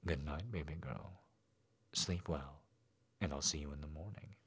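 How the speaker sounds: low, soft and gentle; soft goodnight. A narrator's reading. Daddy saying goodnight to his girl, soft and low. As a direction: tuck her in with your voice. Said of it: "Daddy stated".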